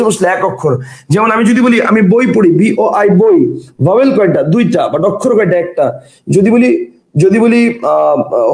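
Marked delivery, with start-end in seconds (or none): none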